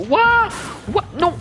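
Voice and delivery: sudden high startled cry; startled yelp. Real, not acted. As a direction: yelp in surprise. Sudden danger in game.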